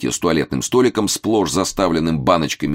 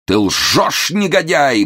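high, loud moan of outrage; anguished groaning cry; 11 semitones higher, 4 dB louder, much slower than usual. A narrator's reading. Momus cries at the valet, high and anguished. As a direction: moan it like a wronged husband. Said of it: "простонал Момус".

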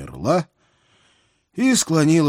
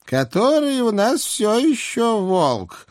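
put-on high, slow old hag question; old witch voice; 14 semitones higher, slower than usual. A narrator's reading. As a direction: probe like a sly old witch. Baba Yaga voiced by reader.

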